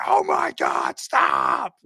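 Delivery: mock shout. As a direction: yell it like losing it. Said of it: "he was like".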